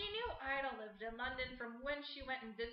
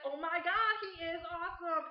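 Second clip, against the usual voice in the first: loud, gushing mock swoon; 5 semitones higher, 8 dB louder than usual. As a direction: gush over him like a smitten fan. [name] gushing over Webster.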